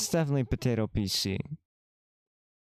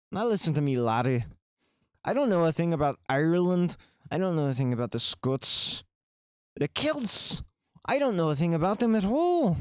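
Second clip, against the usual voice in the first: ranting Irish accent, hammed up; mock Irish accent; 5 semitones higher, faster than usual. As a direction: lecture the laddie in a hammed-up Irish brogue. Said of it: "I'll talk Irish for you".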